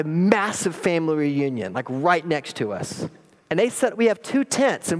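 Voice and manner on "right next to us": ordinary talk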